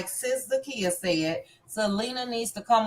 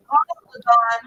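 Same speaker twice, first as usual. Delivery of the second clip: breaking up connection; 6 dB louder than usual. Real, not acted. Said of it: "You're breaking up".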